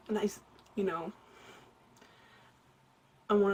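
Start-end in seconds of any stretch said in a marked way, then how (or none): none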